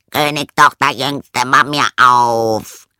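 loud, drawn-out call through a door; frog calling out. A narrator's reading. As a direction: call to be let in, stretching the end. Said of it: "klopfte es an die Tür und rief".

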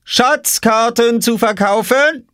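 slow, very high vendor's cry; calling out. A narrator's reading. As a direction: sing out your wares provocatively. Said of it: "rief Oskar hinter seiner Obstkiste". (langgezogener, sehr hoher Ausruf)

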